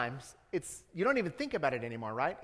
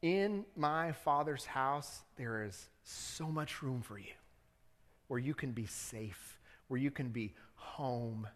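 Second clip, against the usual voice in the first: slow, soft and tender; 3 semitones lower, 4 dB quieter, slower than usual. Real, not acted. Preacher promising listeners a safe home, slow and tender. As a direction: offer shelter gently.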